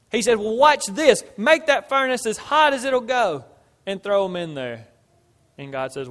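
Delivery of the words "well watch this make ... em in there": loud, high kingly order; defiant command. Retold or imitated. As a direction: call out the order forcefully as a challenge. Preacher acts out king's defiant order.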